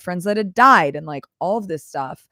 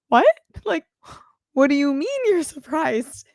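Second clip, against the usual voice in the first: high-pitched confused disbelief; baffled surprise; 9 semitones higher than usual. Retold or imitated. Told the sister was surprised he let her come, high baffled disbelief. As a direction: retell the moment it didn't add up.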